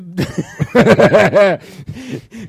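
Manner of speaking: loud burst of laughter; bursting out laughing; stifled giggles. Real, not acted. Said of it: "hör auf zu lachen". (lautes Loslachen)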